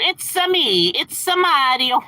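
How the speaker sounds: high-pitched cartoon game-hero impression, last words stretched out; Mario. Streamer greeting viewers with his Mario impression. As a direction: put on a high, drawn-out video game mascot voice. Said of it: "that was my Mario impression".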